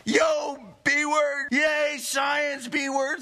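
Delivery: high, hollered slangy exclamations; loud mimicry of a hyped-up kid; excitable kid. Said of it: "He would always say things like".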